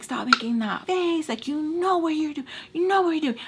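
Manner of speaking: high-pitched imitation of an angry ex; retold screaming. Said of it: "he started screaming".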